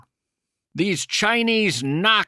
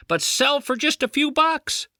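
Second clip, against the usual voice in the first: usual speaking voice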